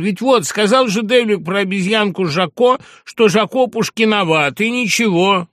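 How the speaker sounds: high, loud remonstrating; urgent persuading. A narrator's reading. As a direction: reason excitedly with angry friends. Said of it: "продолжал уговаривать Пущин".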